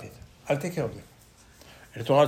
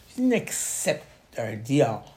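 ordinary delivery

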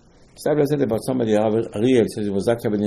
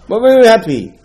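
But very happy, with very punched high and loud; stress on very; 10 semitones higher, 10 dB louder than usual. Acted. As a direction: lift very high and loud, then fall. Stressing joy of the holiday.

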